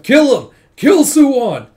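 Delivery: high, very loud shouted command; furious order imitated. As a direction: yell the order to attack. Retold leader ordering a kill.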